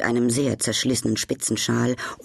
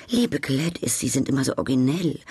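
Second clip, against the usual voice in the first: usual speaking voice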